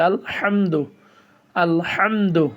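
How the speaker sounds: the হ in আলহামদু pressed in the throat; throaty alhamdu. Shown as a demonstration. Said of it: "গলাকে চেপে পড়তে হবে".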